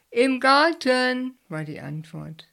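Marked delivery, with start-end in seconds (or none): 0.1-1.3 slow, high, loud call from outside; drawn-out call answering from afar; calling back. A narrator's reading. Julius answers Bert from the garden, slow loud call.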